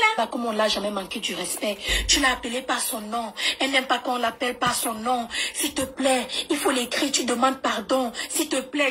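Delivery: ordinary talk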